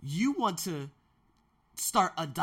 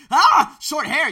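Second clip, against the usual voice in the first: very high, loud, drawn-out ah; overwhelmed exclaim; 13 semitones higher, 18 dB louder, slower than usual. Retold or imitated. Sees short-haired women, loud slow excited exclaim. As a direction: cry out as if stunned by beauty. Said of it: "because I'm like".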